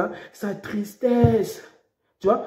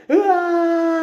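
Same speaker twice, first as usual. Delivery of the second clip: high pretend cry of terror; a short staged scream; mock crash scream; 8 semitones higher than usual. Preacher acting a crash victim's scream.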